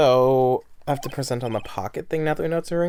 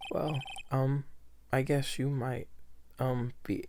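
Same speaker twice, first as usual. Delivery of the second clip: timid witness voice; 10 dB quieter than usual. Acted. Voicing hesitant witness.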